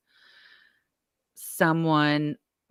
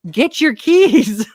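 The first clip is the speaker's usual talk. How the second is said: high, loud, slow yell; 10 semitones higher, 10 dB louder, much slower than usual. Retold or imitated. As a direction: shout the order slowly. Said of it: "I hear the neighbor yell".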